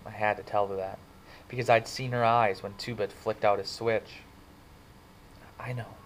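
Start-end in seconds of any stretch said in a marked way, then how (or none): 5.6-5.9 soft, much quieter; quietly. Acted. Cherry quietly agreeing with Ponyboy, soft. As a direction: say it gently under your breath. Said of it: "she said quietly".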